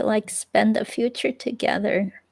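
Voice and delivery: ordinary talk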